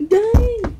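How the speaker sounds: high, loud showy dang; mock cool dang. Kid acting cool after a toss, high loud dang. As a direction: say it like a cool trick-shot guy. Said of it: "I'm trying to be like".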